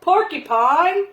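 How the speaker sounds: loud, slow call from outside. A narrator's reading. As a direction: call to someone indoors. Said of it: "Bear called".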